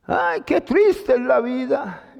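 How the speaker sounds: a touch of syrupy pity from a comforter. Retold or imitated. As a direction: coo over him, mocking the pity. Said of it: "Y llega otro y le dice". (el hermano lastimero, con compasión empalagosa)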